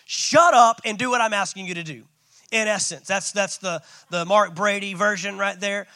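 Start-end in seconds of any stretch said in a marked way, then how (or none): 0.1-2.1 exasperated, louder mock rebuke; a little angry. God losing patience with an arguing Moses, a little angry. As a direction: snap it loudly, mildly angry. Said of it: "God gets a little bit angry".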